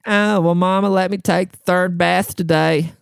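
put-on high, dopey overgrown-child drone; mocking the customer. Coworkers mocking the customer as third bath guy, stupid voice. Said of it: "we started mocking him".

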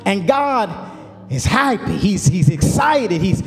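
rising, building sermon cadence with long held words; fiery preaching. Acted. Pastor preaching to his church, climbing, full-force sermon delivery. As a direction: preach it out to the congregation, pitch climbing.

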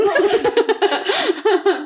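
high bursting laugh. Real, not acted. Laughs at own joke.

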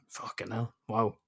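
whispered in quiet amazement; father stunned by his daughter's wisdom, whispering; awed whisper. Retold or imitated. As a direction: breathe it out, stunned. Retold amazement at daughter's sense.

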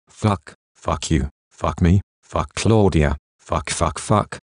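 frustrated swearing through gritted teeth; through clenched teeth. A narrator's reading. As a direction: spit the curses out under the breath, slow. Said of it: "He grits through clenched teeth".

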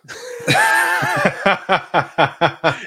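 high, loud cackling laugh; loud real laughter; big burst of laughter. Real, not acted. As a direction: crack up helplessly. Laughing at the joke.

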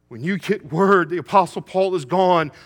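loud, high, driving sermon cry; preaching at full force. Acted. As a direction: shout it to the congregation.

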